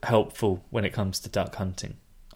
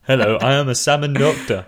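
mock fish doctor; 2 semitones higher, 4 dB louder than usual. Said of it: "a giant fish wearing, like, a lab coat".

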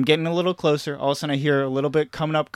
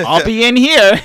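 high, loud, drawn-out reply; hammed-up kid answering mom; calling back; 8 semitones higher, 11 dB louder, slower than usual. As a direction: call out from inside the stall. Kid calls from bathroom stall.

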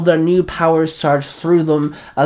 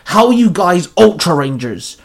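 higher-pitched, indignant challenge; indignant bark; 4 semitones higher, 4 dB louder than usual. A narrator's reading. West storming up to the Ultra Rangers, indignant bark. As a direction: bark the question in disbelief. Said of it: "West barked at them".

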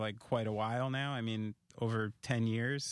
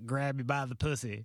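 loose Trump impression, half-laughing; Donald Trump. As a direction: do a rough Trump voice, laughing through it. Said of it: "I'll do my Donald Trump impression".

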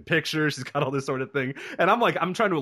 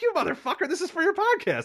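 high, indignant squeal; caught-out shriek, half laughing; mock outrage. Wife discovering the podcast card, high mock outrage. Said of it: "And she's like".

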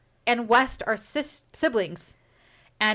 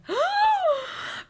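sharp high mock gasp; playful high-pitched gasp. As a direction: gasp in fake shock. Reacting to a threat.

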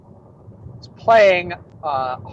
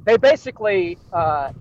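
usual speaking voice

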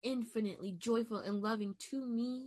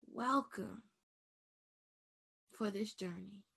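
tender affirmation. Real, not acted. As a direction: speak it like a gentle blessing. Soft self-affirmation, guided calm.

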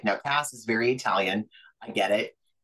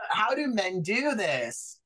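mock disgust; 9 semitones higher, slower than usual. Mock disgusted quip after landing.

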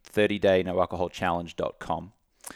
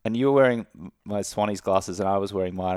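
ordinary talk, nothing marked

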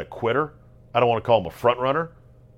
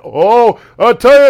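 much higher, louder mock storm-reporter cry; hammed-up reporter; 12 semitones higher, 14 dB louder than usual. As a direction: ham it up like a reporter braced against a hurricane. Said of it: "hamming it up".

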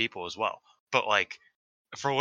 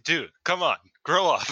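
higher, louder acted scolding; mock exasperation; 6 semitones higher, 5 dB louder than usual. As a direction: retell an exasperated comeback, amused. Acted exasperated reply to wrestler.

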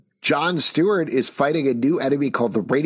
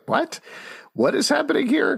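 surprised. Retold or imitated. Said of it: "And I was like".

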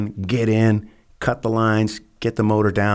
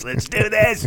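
much higher, pumped-up cry; hyped-up eagerness; slower than usual. Retold or imitated. Eager team ready to go, mock pumped-up cry. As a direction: fire yourself up for the mission. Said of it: "It was like".

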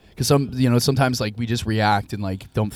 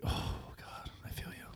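breathy whispered sympathy; hushed whisper of pity; 20 dB quieter, slower than usual. Retold or imitated. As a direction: whisper it under your breath. Sympathizing with fallen couple.

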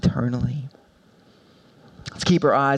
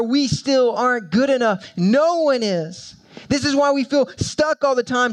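higher, driving sermon voice pressing each point; full-force preaching; 10 semitones higher than usual. Acted. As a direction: preach it hard, building the urgency.

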